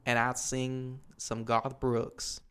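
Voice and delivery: long, slow southern drawl; country southern accent. Shown as a demonstration. Reminiscing on singing at the bar, southern drawl. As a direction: stretch it into a big country twang, southern style. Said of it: "My country southern accent".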